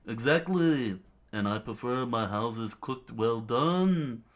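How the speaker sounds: high, drawn-out royal self-satisfaction; gloating king. Acted. King agreeing with his flattering minion, gloating.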